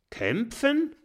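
hohe, empörte Frage; Kämpfen? ungläubig und entrüstet; empört. Acted. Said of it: "fragt Arngrim der Stupsnäsige empört". (Arngrim fragt empört nach dem Kampf)

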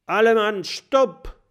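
hoch und laut, abrupter Haltbefehl; laut gerufen. Acted. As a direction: stopp die Truppe mit einem Ruf. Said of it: "Plötzlich aber ruft Erik". (Erik ruft seinen Männern ein lautes Halt zu)

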